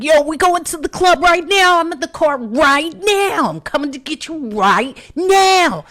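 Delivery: high, loud hype-man bravado. Retold or imitated. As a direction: boss it like a drunk mogul. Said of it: "I've turned into [name]".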